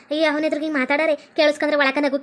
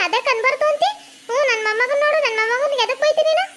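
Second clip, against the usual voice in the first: high-pitched, put-on granny voice; arguing mother-in-law; 8 semitones higher than usual. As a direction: hit back as the indignant grandmother. Plays mother-in-law arguing back. (ವಾದಿಸುವ ಅತ್ತೆಯ ಎತ್ತರದ ಧ್ವನಿ)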